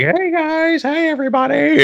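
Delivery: very high, friendly cartoon greeting; Death's high-pitched voice. Death greets everybody in this anime, high-pitched and friendly. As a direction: put on a squeaky-high, over-friendly hello. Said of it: "really high pitched voice".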